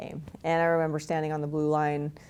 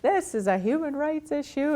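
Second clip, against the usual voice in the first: mock outraged mom, pitched much higher; mom going ballistic; 10 semitones higher than usual. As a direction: imitate a mother hitting the roof in high-pitched indignation. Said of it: "went ballistic".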